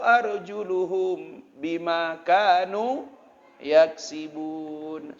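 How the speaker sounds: slow, chanted Arabic recitation; Quran recitation. Acted. Preacher reciting a Quran verse to the congregation. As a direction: draw out each word in melody.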